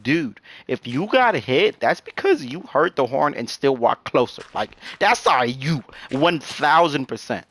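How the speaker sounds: slow, punched word by word; emphatic blame. Retold or imitated. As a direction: spell it out slowly, laying the blame on them. Retold emphatic blaming of careless walker.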